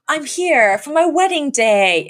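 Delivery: high, drawn-out dramatic announcement; mock grand entrance. Voicing show character's dramatic wedding entrance.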